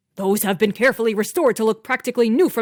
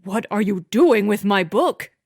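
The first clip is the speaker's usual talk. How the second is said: louder, indignant rising question; possessive anger; 5 dB louder than usual. A narrator's reading. Husband confronting wife over his book, indignant. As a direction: demand it back, affronted.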